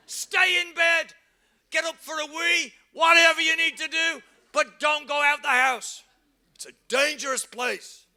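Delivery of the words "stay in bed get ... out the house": firm command. Real, not acted. Preacher ordering people to stay home, firm and raised. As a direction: lay down the law.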